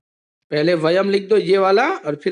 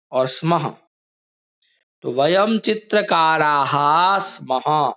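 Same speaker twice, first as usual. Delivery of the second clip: ordinary delivery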